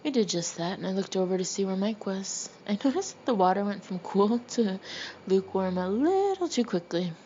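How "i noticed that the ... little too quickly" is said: ordinary delivery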